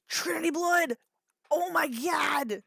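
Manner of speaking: gasp, then high breathy surprise; gasp of delight. Real, not acted. Streamer spotting Trinity Blood, delighted gasp. Sudden recognition of anime.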